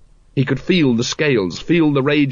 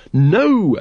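raised, ringing triumph; triumphant; 7 semitones higher, 4 dB louder than usual. A narrator's reading. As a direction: declare it triumphantly, pitched up. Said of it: "said the Supreme Grand Master triumphantly".

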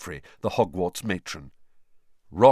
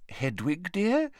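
distracted; 11 semitones higher, slower than usual. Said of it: "said Missus Weasley distractedly".

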